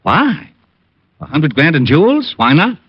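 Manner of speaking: high, scoffing disbelief; incredulous. Acted. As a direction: toss the question back as if it's obvious. Partner scoffs at his doubt.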